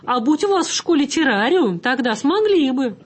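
put-on a schoolmasterly old man setting them straight; instructive. A narrator's reading. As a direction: wag a finger at them as you say it. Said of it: "наставительно отозвался старичок".

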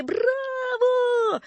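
very high, long-held cheer; crowd's bravo cry. A narrator's reading. Audience applauding Napoleon, high drawn-out cry. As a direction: hold the cheer on one high note. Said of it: "Все закричали".

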